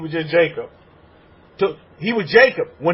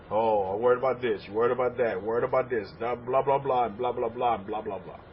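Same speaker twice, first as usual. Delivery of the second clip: softer, lower mumble mimicking worriers; mocking worry chatter; 5 semitones lower, 7 dB quieter than usual. Rabbi mimicking worried people's endless fretting, softer and lower. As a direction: dismiss their fretting as blah blah.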